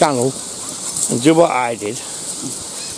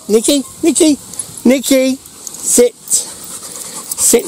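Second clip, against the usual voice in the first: dog command; 9 semitones higher, much slower than usual. Real, not acted. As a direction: call the dog and order it to sit.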